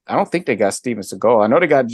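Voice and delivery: usual speaking voice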